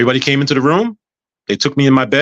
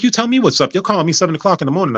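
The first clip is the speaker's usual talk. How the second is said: high, quick imitation of an aunt; retold indignation; 6 semitones higher than usual. Aunt answers early morning phone call, mock indignant. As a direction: fire the question back, pitched up.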